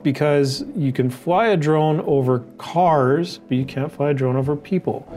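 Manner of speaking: ordinary talk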